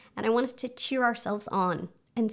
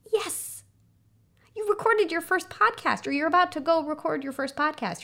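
high, delighted cheer, settling slightly; champion voice; 7 semitones higher than usual. Acted. Inner champion praising a first podcast, high excited cheering. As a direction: celebrate their first success in a raised pitch. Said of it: "I wanna listen to that champion voice".

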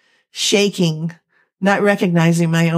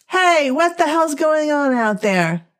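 much higher voice yelling from a window; a stranger shouting down into the alley; startled neighbour calling out; 9 semitones higher than usual. A narrator's reading. Apartment dweller calling out.

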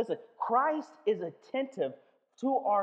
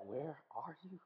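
1 soft plea; 5 semitones lower, 15 dB quieter than usual. Retold or imitated. Calling to a sleeping God.

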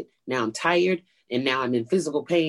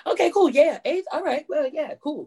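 much higher, overly bright okays; fake chipper; 12 semitones higher than usual. Newbie comic fake-casually accepting a late slot, squeaky high. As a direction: play it cool in a high squeaky voice. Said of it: "I was like".

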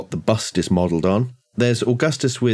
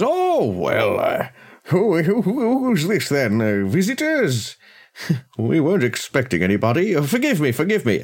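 higher-pitched, fussy householder surprised by visitors; flustered genial host; 7 semitones higher than usual. Acted. Master of the house finds unexpected visitors, flustered high host voice. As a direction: stammer a high, apologetic welcome.

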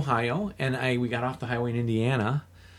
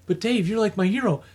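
higher, pleading friend; a lightly voiced pleading Ray; 8 semitones higher than usual. Ray begging his hero to stay, lightly voiced.

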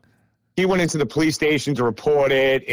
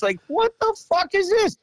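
much higher, outraged squawk at the purple car; fuming outrage. Retold or imitated. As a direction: replay the fury at the driveway. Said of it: "foaming at the mouth".

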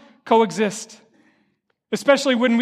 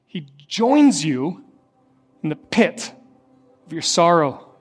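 tender preaching; 7 semitones lower than usual. Real, not acted. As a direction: lower the voice and comfort them. Tender sermon turn over music.

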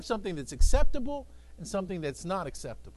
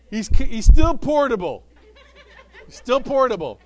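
louder, through laughter; laughing; 3 semitones higher, 12 dB louder than usual. Real, not acted. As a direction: chuckle it out. Laughing about helper.

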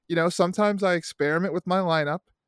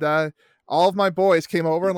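ordinary delivery

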